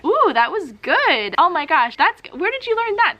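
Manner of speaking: impressed cheerleader squeal; super encouraging. Acted. Teacher reacts to a student's good sentence, gushing encouragement. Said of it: "I'm super encouraging".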